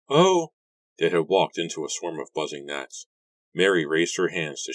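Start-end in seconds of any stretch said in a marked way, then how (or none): 0.1-0.5 high, loud exclamation of surprise; sudden startled outburst; startled cry. A narrator's reading. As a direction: yelp as if caught off guard. Walking into a swarm of gnats.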